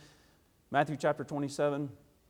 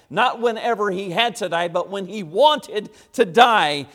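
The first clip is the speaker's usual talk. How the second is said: raised preacher's voice, slowing to land the point; emphatic preaching; 6 semitones higher, 12 dB louder than usual. Acted. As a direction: drive the contrast home loudly. Preaches at full force.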